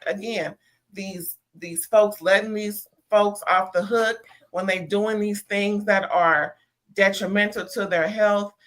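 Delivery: ordinary talk, nothing marked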